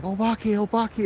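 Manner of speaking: high panicked repeat; wife frightened, telling her husband; frightened cry. Retold or imitated. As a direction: babble it out, still terrified. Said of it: "And she's like".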